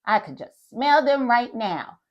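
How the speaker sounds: plain reading voice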